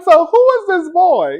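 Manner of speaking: pry eagerly; nosy aunt. Retold or imitated. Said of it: "And I was like".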